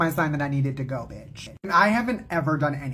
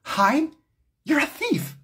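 higher, indignant retort; incredulous outrage; 10 semitones higher than usual. Retold or imitated. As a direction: fire back in shocked indignation. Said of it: "I said".

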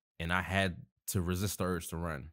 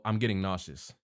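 ordinary delivery, nothing marked

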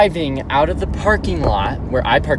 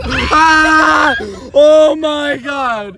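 high, loud, slow-drawn yell; startled shout; 10 semitones higher, 4 dB louder, much slower than usual. Real, not acted. [name] startled in the car, high shout. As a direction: cry out in shock.

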